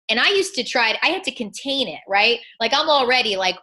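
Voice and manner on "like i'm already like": ordinary talk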